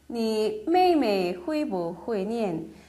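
each syllable spelled out slowly; slow model reading. Shown as a demonstration. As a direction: read it slowly for learners. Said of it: "뿌는 약하게 읽어요". (천천히 또박또박 읽는 예문)

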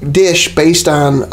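ordinary talk, nothing marked

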